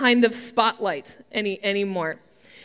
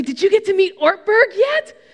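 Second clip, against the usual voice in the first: higher, excited mimicry of a starstruck friend; eager friend; 6 semitones higher, 4 dB louder than usual. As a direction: imitate an excited fan's question, pitched up. Friends eagerly asking about celebrity pastor.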